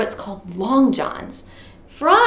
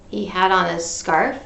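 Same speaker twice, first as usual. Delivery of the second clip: ordinary delivery, nothing marked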